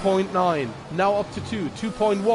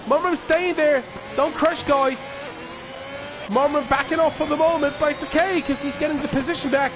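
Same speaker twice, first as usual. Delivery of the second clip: motorsport commentator; 5 semitones higher than usual. Real, not acted. Post-race results commentary.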